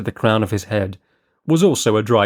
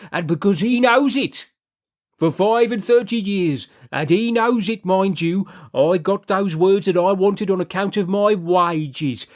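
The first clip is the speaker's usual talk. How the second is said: higher, fervent lay-preacher delivery; preacher's voice; 9 semitones higher than usual. A narrator's reading. Brother Hawkyard exhorting his fellow sinners, higher preaching voice. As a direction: preach it like a self-satisfied chapel expounder.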